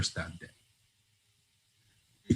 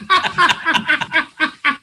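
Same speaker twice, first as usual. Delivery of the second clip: high burst of real laughter; laughing hard at the joke; big laugh. Real, not acted. Guest cracking up at the host's remark, laughing.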